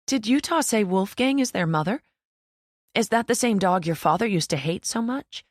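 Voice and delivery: the mother asking, mildly lifted; lightly voiced mother. A narrator's reading. Mother asks her child about the dog, lightly voiced.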